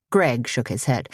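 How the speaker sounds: plain reading voice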